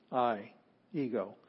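usual speaking voice

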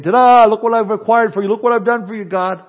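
hammed-up boast to God; boastful show-off. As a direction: play a proud man presenting his gift. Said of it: "brought before him saying".

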